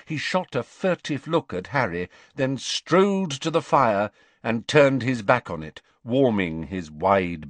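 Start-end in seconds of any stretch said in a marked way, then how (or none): none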